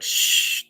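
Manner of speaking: hushing sound; soft voiceless shush. Retold or imitated. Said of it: "they very much are like".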